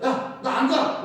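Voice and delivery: loud, high, slow grumbling shout; mock complaining yell. Preacher acting out a grumbling Israelite, loud refusal. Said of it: "뭐 이러는 거예요".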